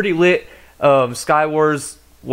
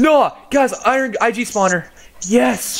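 excited yell; 8 semitones higher than usual. Real, not acted. Rare spawner from uncommon key.

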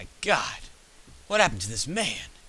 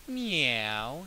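long, falling cat meow; imitated cat meows; 6 semitones higher than usual. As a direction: mimic a girl meowing like a cat. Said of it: "Starts making these cat noises".

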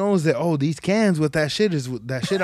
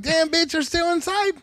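much higher, mock shocked; amused surprise; 12 semitones higher than usual. Finding his dog inside the trash bag, high and amused. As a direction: catch the dog red-handed.

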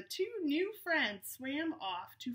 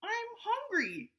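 much higher, slow little-shark voice; high childlike voice for a hungry young shark; Gilbert the shark; 11 semitones higher, slower than usual. Acted. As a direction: draw out a sudden hungry whine. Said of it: "Gilbert said suddenly".

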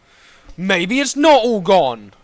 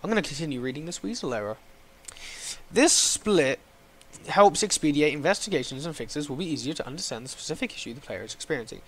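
low, soft, rapid read-out; deflated reading; 7 semitones lower, 11 dB quieter, faster than usual. Real, not acted. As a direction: read the help text under your breath, quickly. Deflated, reads error text aloud.

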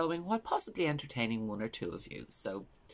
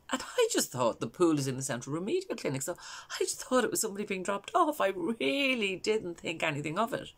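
higher-pitched, insistent mother explaining herself; Valerie; 7 semitones higher than usual. Acted. Mother explaining why she wasn't alarmed by the ambulance, high and insistent. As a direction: lift the voice, stressing the innocent assumption. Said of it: "this is a character called Valerie".

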